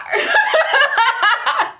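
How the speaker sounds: laughs high and loud at the memory; high burst of laughter. Real, not acted. Laughing at the gif's nerve. Laughing at her own story.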